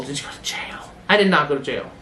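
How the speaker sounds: hushed mock question and answer; whispering about jail to oneself. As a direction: whisper it like a guilty secret.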